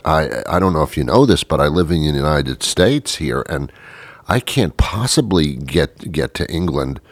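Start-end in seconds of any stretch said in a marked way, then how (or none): none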